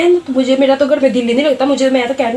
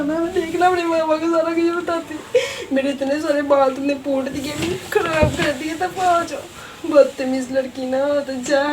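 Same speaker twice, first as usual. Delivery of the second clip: higher, tearful whining after a beating; whiny crying; 4 semitones higher, 4 dB quieter than usual. Acted. Beaten cousin threatening to tell her mom, crying and whining. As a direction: whimper it out like a hurt spoiled child. Beaten girl whines to tattle.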